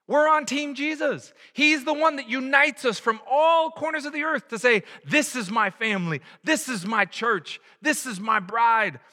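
high, ringing preacher's voice; fervent proclamation. Real, not acted. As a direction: proclaim it to the whole church. Preacher proclaims at sermon peak.

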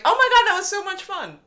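high, loud imitation of a thrilled mom; over-bright retold enthusiasm; mock gushing. Retold gushing mother after party.

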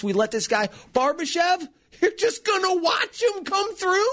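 high, exasperated fan yelling at the screen; rising disbelief at the defence; frustrated fan yell. Retold or imitated. Fan yelling at hockey replay.

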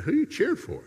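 a high, suspicious wife voice, lightly done; imitating his wife. Wife grilling husband over the football game, high mocking voice. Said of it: "She said".

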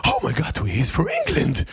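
gasping, mock-astonished reveal; playful pretend shock; mock amazement. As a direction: gasp as if it's a huge discovery. Teasing mock amazement at accent attempt.

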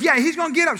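volunteer him, pitched right up; acted brisk overruling; insistent wife.

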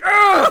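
loud, high grunted shout; mock effort yell. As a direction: act out a vicious squeeze with a yell. Mimics the iron claw squeeze.

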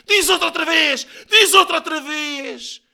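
high, loud movie-style threat; hammed-up shouted ultimatum; menacing shout. A narrator's reading. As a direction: yell it, daring him to repeat. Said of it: "já gritava".

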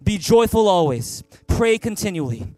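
full-voiced congregational reading; big loud voice. Real, not acted. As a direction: boom it out with the whole room. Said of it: "Read with me, big loud voice".

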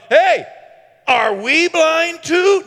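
high, loud, drawn-out sassy challenge; saucy. Acted. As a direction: talk back with sass, slowly. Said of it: "now they're getting saucy".

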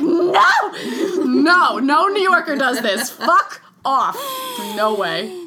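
loud, laughing outburst of horror; mock scream at the coffee sip. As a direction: shout it through laughter like a scandalized New Yorker. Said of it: "I was like".

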